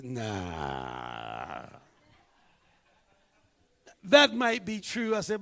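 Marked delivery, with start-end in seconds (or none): 0.0-1.7 deep, drawn-out dismissive nah; low dismissive nah. Retold or imitated.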